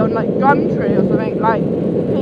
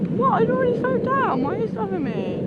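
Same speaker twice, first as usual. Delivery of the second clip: high-pitched, quick complaint; exasperated squeal at being held up; indignant protest; 9 semitones higher, 4 dB quieter, faster than usual. Real, not acted. Protesting at being made to stop.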